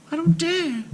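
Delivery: put-on high, small timid girl voice; shy child barely daring to speak; timid little girl. A narrator's reading. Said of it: "said Marusha timidly".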